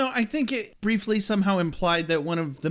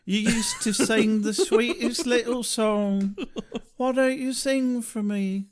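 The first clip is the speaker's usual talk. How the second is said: slow, higher, drawn-out plaintive pleading; creepy character voice; 4 semitones higher than usual. Acted. Drusilla pleading with her bird to sing, creepy and slow. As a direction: draw out each line in a put-on British voice.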